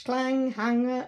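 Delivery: usual speaking voice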